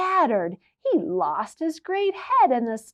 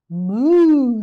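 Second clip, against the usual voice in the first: cow moo; 9 dB louder than usual. Acted. Cow in a terrible mood mooing. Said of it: "said the cow".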